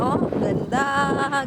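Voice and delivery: ordinary delivery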